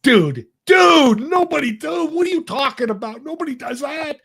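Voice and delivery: high, loud, incredulous surfer-dude shout. Retold or imitated. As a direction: cry out like a disbelieving surfer dude. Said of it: "He's like a surfer dude".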